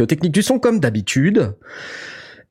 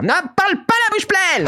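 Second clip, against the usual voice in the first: high scolding carer voice; scolding nurse. Pretend. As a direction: chide like a strict nurse in grey. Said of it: "je vois quelqu'un à côté de toi dire".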